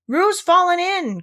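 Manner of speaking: high, slow alarmed cry; cried out. Acted. Rabbit sees Roo fall in, cries out high and slow. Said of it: "cried Rabbit".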